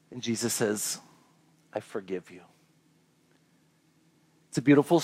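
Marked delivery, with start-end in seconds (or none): 1.7-2.4 very soft, low, gentle; almost breathe it, full of mercy; tender forgiveness. Acted. Jesus speaking to Peter after the denial, very soft and tender.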